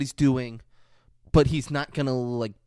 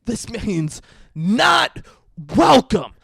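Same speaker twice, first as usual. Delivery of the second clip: loud, high, slow taunt; hammed-up skinhead yelling; mock taunting shout; 5 semitones higher, 9 dB louder, slower than usual. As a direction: draw it out while you jeer. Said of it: "He's like".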